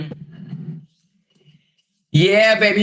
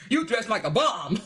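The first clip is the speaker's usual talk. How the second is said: higher, indignant mock voice; mock Kevin defending his wardrobe, higher and indignant; mocking offended Kevin; 5 semitones higher than usual. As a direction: play him outraged about his clothes. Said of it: "that pissed Kevin off too".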